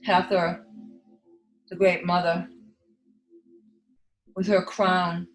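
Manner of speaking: ordinary delivery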